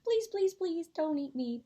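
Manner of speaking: high, loud desperate pleading; begging mouse. A narrator's reading. Tiny mouse begging the lion, high frantic plea. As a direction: plead for your life in a squeak. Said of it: "begged the mouse".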